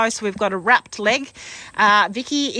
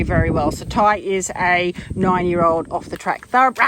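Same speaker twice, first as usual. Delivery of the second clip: ordinary delivery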